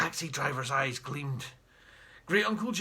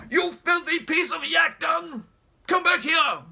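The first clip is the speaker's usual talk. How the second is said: loud, slow raging bellow; furious shout; 10 semitones higher, 7 dB louder than usual. Acted. Jeremy raging at the driver speeding off, shouting. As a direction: roar after the fleeing driver. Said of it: "cried Great Uncle Jeremy".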